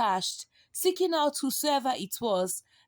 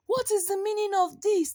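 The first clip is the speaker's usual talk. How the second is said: high, alarmed cry of villagers; shocked outcry, voice jumping up; frightened villagers cry out in shock; 9 semitones higher than usual. A narrator's reading. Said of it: "they cried".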